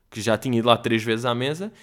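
ordinary talk

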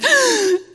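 high sharp gasp through tears; tearful gasp. Retold or imitated. Daughter gasps through her tears. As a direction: catch the breath like a crying toddler. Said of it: "through her tears".

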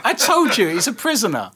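high, loud, laughing crow of vindication; triumphant outburst. Real, not acted. Host crowing to co-host that the criminal guess was right, triumphant shout. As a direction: shout it like you've won the bet.

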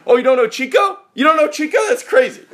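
loud, high New York cabbie disbelief; incredulous cabbie shout. Retold or imitated. Cabbie shouting at his passenger who doesn't know Chico, loud disbelief. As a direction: shout it like he can't believe you missed it. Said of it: "He's like".

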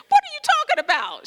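exasperated retort. Retold or imitated. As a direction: fire it back at her in exaggerated disbelief. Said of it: "I wanted to look at her and say".

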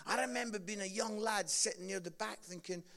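usual speaking voice

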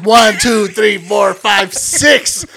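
imitating an exasperated yell; loud correction. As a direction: correct him loudly, number by number. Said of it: "Loud as fuck".

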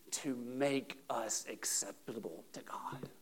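slow, soft, near-whispered close; hushed emphasis. Real, not acted. Preacher on being acceptable to God, hushed. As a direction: let it sink to a reverent hush. Preacher lowers voice on key point.

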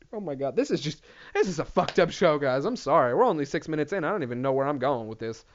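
ordinary talk

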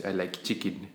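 low, soft talking-dog voice; lightly voiced pet dog, quiet and low; dog's voice. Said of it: "What does it sound like? She goes".

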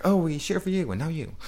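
high, bright mock cheering; mock cheerleader voice. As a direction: imitate an eager cheering fan. Mocking songwriters cheering.

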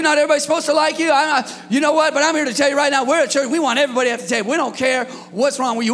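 loud, driving pulpit preaching; rally the church, fast; fired-up sermon delivery; full-force preaching. Acted.

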